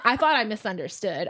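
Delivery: ordinary talk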